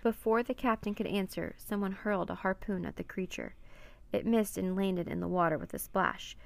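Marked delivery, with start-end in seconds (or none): none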